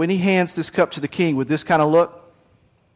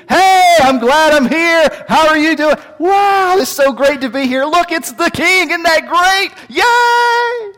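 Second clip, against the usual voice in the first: much higher, bright fake enthusiasm; forced cheerful; 11 semitones higher, 5 dB louder, slower than usual. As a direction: gush like a courtier terrified of not smiling. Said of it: "you had best be smiling".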